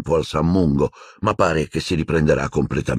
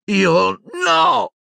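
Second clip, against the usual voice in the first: much higher, throat-tearing yell; screaming; 3 dB louder than usual. A narrator's reading. Boy screaming denial at the headmaster. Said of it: "urlò Harry".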